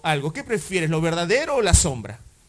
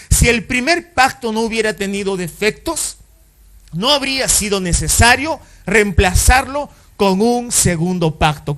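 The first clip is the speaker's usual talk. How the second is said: a touch louder reading of the verse; preaching scripture aloud; 2 semitones higher, 5 dB louder than usual. Acted. Pastor reading the verse to the congregation, a touch louder. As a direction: read the verse out to the church.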